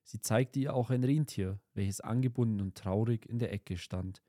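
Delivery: plain reading voice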